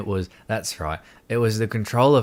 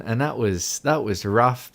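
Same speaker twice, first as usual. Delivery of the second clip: ordinary talk, nothing marked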